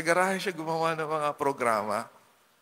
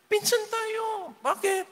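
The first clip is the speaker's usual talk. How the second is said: very high, slow, sugary claim; fawning relative; slower than usual. Retold or imitated. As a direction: coax the rich one into calling you cousin.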